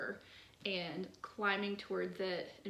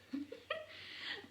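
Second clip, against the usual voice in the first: breathy high giggle; 6 dB quieter than usual. Real, not acted. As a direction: giggle at the poem. Laugh after God quote.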